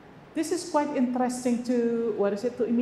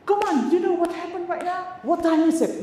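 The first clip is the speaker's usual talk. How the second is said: put-on higher, pushy hurry-up to a kid; impatient nagging; 8 semitones higher, 4 dB louder than usual. Shown as a demonstration. Teacher shows the wrong way to hurry kids, impatient higher nagging. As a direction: nag the child to hurry, pitched up and pressing. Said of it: "Nggak mungkin seperti itu".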